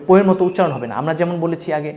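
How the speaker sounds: ordinary delivery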